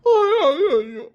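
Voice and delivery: high, loud fake wail of aiyo; mock wailing. Husband mimicking his wife's crying, mock wail. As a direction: parody her crying cruelly.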